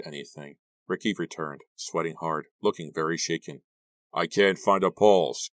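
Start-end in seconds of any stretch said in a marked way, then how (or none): 4.1-5.5 loud, slow, drawn-out call; raised voice, stretched words. A narrator's reading. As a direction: call it up to the others. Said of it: "Gary called up".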